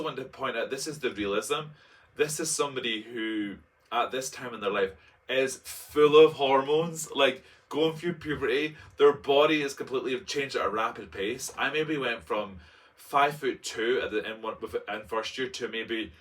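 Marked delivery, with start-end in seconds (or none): none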